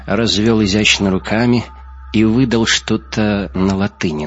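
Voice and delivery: plain reading voice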